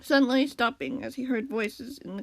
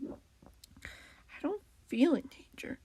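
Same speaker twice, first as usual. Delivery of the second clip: hesitant admission; 4 semitones higher, 5 dB quieter, much slower than usual. A narrator's reading. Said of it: "the cat admitted".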